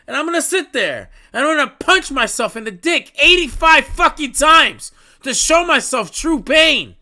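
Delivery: loud, high, furious rant; frustrated rant. Real, not acted. Ranting at Oda about wasted time, furious and loud. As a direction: rage at the author, loud and high.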